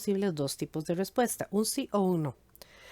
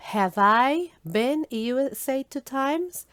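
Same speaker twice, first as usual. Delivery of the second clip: slow example question; 5 semitones higher, 4 dB louder, slower than usual. Shown as a demonstration. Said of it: "Por ejemplo".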